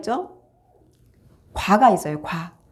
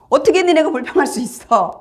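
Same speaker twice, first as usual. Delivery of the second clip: raised, sharper rebuke of the grumblers; angry rebuke; 5 semitones higher than usual. Retold or imitated. Moses scolding the complaining Israelites, angry rebuke. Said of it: "막 분을 내죠".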